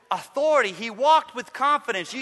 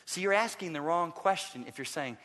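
usual speaking voice

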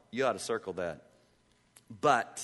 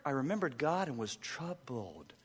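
quiet reflection; 2 semitones lower, 7 dB quieter than usual. Shown as a demonstration. Pastor repeating the verse softly for the church to ponder. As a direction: say it gently, almost to yourself.

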